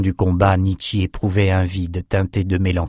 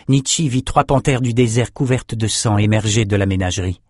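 ordinary delivery, nothing marked

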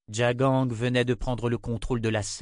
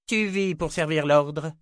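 cold reminder; 6 semitones higher, 3 dB louder than usual. Acted. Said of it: "lui rappela froidement la femme".

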